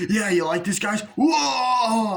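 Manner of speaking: high, loud party whoop; mock party shout building to a whoop; hyped-up yell. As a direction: whip up the crowd at the nightclub. Impersonating Nick Castle's hyped cameo.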